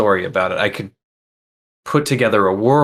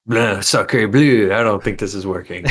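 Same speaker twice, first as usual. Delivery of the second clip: mock French accent. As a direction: ham up a French film actor in a French accent. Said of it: "like a French film sort of thing".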